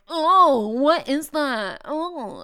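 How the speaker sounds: high, loud mock disgust; kids grossed out. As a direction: act out kids recoiling from a smell. Kids react to strong smell.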